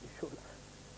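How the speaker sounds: soft whispered aside, slow. Real, not acted. Contestant to himself mid-question, slow whisper. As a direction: murmur it to yourself under pressure.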